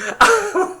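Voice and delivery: high burst of laughter; real laughing fit. Real, not acted. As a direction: break up laughing after trying to speak.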